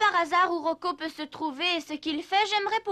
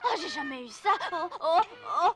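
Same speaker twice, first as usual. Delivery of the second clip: gémissements paniqués plus aigus; panique affolée; 4 semitones higher than usual. Acted. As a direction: crier au secours, affolé. Pieds qui dansent seuls, panique. (gémir de panique, pieds incontrôlables)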